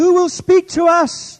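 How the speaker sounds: high, full-force desperate shout; shouted cry for help. Acted. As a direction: yell it as a plea from the lost. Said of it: "That's what they're crying out".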